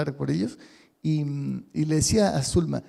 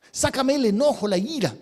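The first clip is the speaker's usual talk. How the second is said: pleading angry prayer; 6 semitones higher than usual. Pretend. Acted angry prayer continues.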